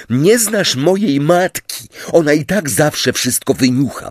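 cross, exasperated protest; snarled retort. A narrator's reading. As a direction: snap back defensively. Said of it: "warknął Simius".

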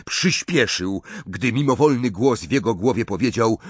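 ordinary delivery

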